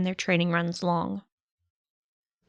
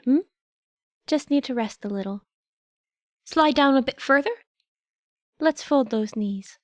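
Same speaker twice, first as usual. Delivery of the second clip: gentle small creature; 4 semitones higher than usual. Acted. Nezu guiding Shota's breathing.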